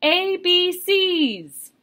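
high, drawn-out excited letters; excited poem reading, voice held high; excited exclamation. Shown as a demonstration. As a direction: call out the title with an exclamation mark. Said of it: "practice using those punctuation marks".

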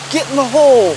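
yelled cheer, pitched high; cheering shout. Real, not acted. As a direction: shout it at the target. Cheering louder second time.

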